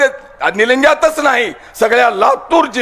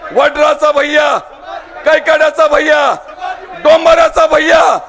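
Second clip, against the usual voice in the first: building shouted list, climbing higher with each call; rally call; 7 semitones higher than usual. Real, not acted. Politician calling lines for the crowd to answer, building shout. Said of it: "मी काही म्हणलं तर तुम्ही संभाजी भैया म्हणायचं".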